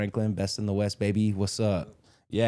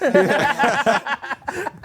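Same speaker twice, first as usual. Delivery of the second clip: laughing; 7 dB louder than usual. Real, not acted. Bursts out laughing.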